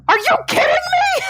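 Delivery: high-pitched incredulous squawk; mock disbelief. Imitating roommate's husband learning the accent was fake, high incredulous cry. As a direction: squeal it in retold disbelief.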